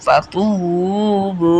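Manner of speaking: very slow "ফাতুবু" with a long-held "তু"; recited "ফাতুবু", vowels greatly prolonged; three-alif elongation. Shown as a demonstration. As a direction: stretch "তু" and "বু" far out. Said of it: "টান হবে".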